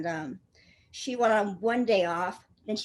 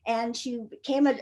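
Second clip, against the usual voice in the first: ordinary talk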